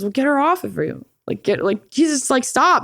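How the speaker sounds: high, urgent plea to stop; mock frantic dad; dad yelling stop. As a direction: break up the fight.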